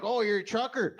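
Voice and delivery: high, excited mock voice; Freedom mom. Bolton Freedom mom gushing at the trucker, high mock voice. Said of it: "they're like".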